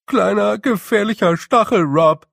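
high, loud, fretful little voice; squeaky wail. Acted. A small blue man wails in fear at the snow. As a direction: wail it like a panicking small creature. Dover answers.

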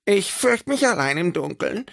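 high, fearful little creature voice; scared. Acted. Little creature to friends, fearful and high. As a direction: whimper out the fear of the dark.

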